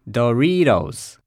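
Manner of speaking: ドリトス slowly as American "duh-REE-dohs"; 英語発音. Shown as a demonstration. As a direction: stretch and stress the REE in ドリトス for learners to repeat. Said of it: "と発音します". (ドリトスを「ドゥリードウズ」とゆっくり英語式に)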